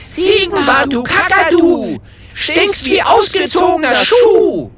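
very high, loud taunting yell; loud mocking shout. A narrator's reading. Friends yell a mocking rhyme at the wizard, very loud and high. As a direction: holler the insult rhyme.

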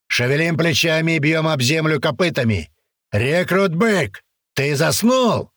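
high barking drill instructor; drill sergeant. A narrator's reading. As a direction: bark it at sleepy recruits. Sergeant scolds recruits in formation.

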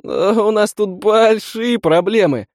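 high, slow, panicky cry; panicked. Acted. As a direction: voice of a terrified youth. Panicked youth under attack.

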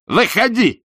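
put-on high, loud drunken order; shouting. A narrator's reading. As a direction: shout her out of the room. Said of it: "закричал". (пьяный крик «Выходи!»)

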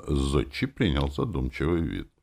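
usual speaking voice

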